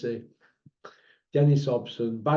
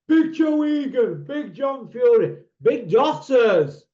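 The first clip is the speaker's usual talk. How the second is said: high, loud mocking call; raised, punchy ridicule of the billing; mock big-name announcement; 7 semitones higher, 6 dB louder, slower than usual. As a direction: boom out each name in derision. Mocks the announced boxing names.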